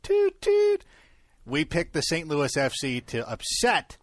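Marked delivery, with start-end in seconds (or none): 0.0-0.8 high loud horn toot. Pretend. Mock horn tooting.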